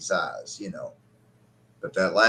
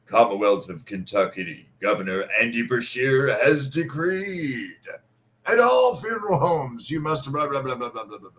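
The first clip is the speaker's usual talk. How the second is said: higher, pompous proclamation voice, trailing into blah blah; mock official decree; 4 semitones higher than usual. Mourner reading the governor's notice on the funeral home door, mocking. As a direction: read the notice like a pompous herald. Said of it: "all this shit posted on the door".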